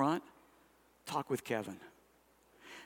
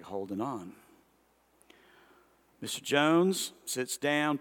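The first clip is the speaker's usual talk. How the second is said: normal talking voice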